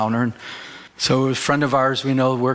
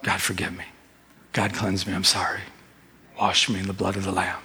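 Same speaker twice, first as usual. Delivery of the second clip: much softer, lower pleading prayer; soft, lower, contrite whispered-style prayer; hushed prayer; 7 semitones lower, 6 dB quieter than usual. Pretend. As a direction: pray it low and humble, asking forgiveness. Acting out a repentant prayer.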